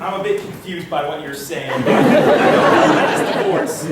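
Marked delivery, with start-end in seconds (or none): 1.8-3.8 slow, loud line dissolving into laughs; can't hold it together, laughing through it; breaking into laughter. Real, not acted. Scene partner cracks up mid-line.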